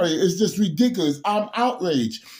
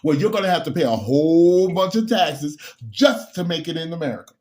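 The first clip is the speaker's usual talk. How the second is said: normal talking voice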